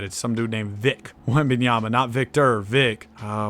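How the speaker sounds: ordinary delivery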